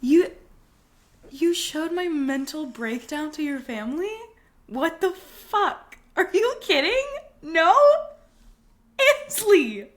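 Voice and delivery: high, loud, incredulous protest; scandalized at a friend's betrayal, playing it up; aghast, rising into shrill disbelief; mock outrage. Learns friend showed breakdown to family.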